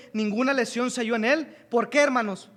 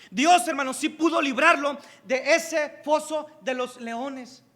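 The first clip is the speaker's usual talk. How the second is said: higher, louder, rising fervour; fiery sermon, voice climbing; preaching at full force; 4 semitones higher, 4 dB louder, slower than usual. Acted. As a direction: build the congregation up with each line.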